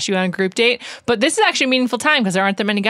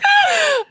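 mock gasp.

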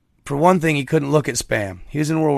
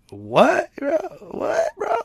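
baffled squeak; slower than usual. Retold or imitated. Grandpa baffled by dead meat.

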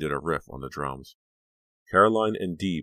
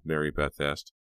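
ordinary delivery

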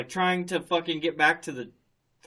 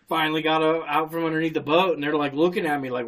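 ordinary delivery

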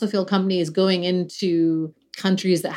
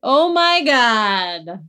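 very slow, loud, raised outcry; appalled disbelief; 5 semitones higher, 5 dB louder, much slower than usual. Real, not acted. Co-host hearing the cancer excuse, appalled. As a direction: stretch out each word in disbelief. Appalled at cohost's mocking paraphrase.

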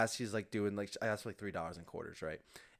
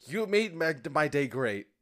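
higher, louder imitation of an overjoyed worker; so happy; 4 semitones higher, 7 dB louder, slower than usual. Toll worker answering "have a great day", overjoyed. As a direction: gush it like a thrilled toll worker. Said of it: "She was so happy about giving out quarters".

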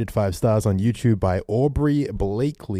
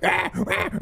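monkey noises; 9 semitones higher than usual. Pretend. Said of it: "at first they're like".

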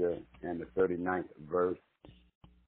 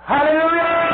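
shouted hallelujah; 12 dB louder than usual. Real, not acted. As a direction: scream out a long hallelujah at full force. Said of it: "you just shout".

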